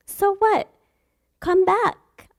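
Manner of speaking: high, loud calling out So what; loud call back. Retold or imitated. Preacher as God calls the sinner back, high and loud.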